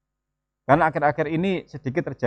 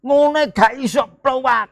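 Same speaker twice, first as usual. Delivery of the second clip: put-on much higher, sharp scolding of a child; raised intonation; 11 semitones higher, slower than usual. Shown as a demonstration. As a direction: show the parent losing patience, pitch shooting up. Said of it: "intonasi meninggi". (nada tinggi memarahi anak)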